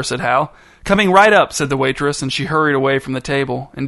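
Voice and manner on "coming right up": high, loud and eager. A narrator's reading. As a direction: chirp it out keen to please. Eager waitress hurries off.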